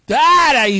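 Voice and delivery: sudden loud high stop; telling someone to stop. Retold or imitated. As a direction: cut them off hard. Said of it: "le digo así".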